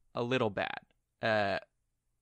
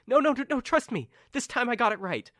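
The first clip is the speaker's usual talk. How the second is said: high-pitched, pleading insistence; insistent Carmen; 10 semitones higher, 4 dB louder than usual. Acted. Carmen assures doubting companions she did the job, insistent. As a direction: plead to be believed, pitched well up. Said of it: "And she's like".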